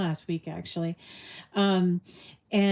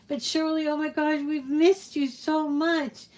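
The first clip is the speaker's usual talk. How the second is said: delighted greeting; 7 semitones higher than usual. Real, not acted. Host to long-absent friend joining the show, delighted gushing. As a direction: gush at a friend you've really missed.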